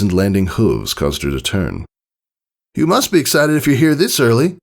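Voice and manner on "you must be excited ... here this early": high, breezy, cocky tomboy voice. Acted. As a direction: toss it off with a grin. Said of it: "Rainbow Dash remarked".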